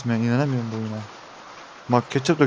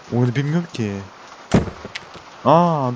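usual speaking voice

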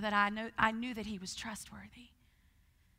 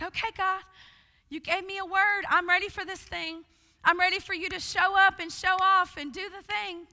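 excited prayer; 6 semitones higher, 6 dB louder than usual. Retold or imitated. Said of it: "I'm like".

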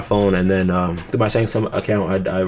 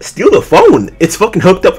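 much higher and louder, indignant; outraged retort; 9 semitones higher, 7 dB louder, slower than usual. Retold or imitated. Accused customer shouting back at the clerk, indignant and loud. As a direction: fire it back, stunned and furious.

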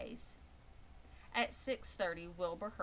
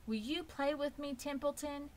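higher, eager pig asking; hopeful young pig; 9 semitones higher than usual. Acted. Wilbur the pig asks Templeton to play, high and hopeful. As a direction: ask the rat hopefully.

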